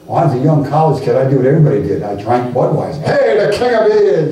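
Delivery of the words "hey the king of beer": mock beer cheer. College kid cheering Budweiser.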